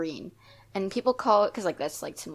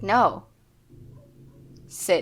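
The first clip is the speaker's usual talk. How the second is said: a louder, curt command; stern order; 7 dB louder than usual. Retold or imitated. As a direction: order her down like a dog. Lady ordering her to sit.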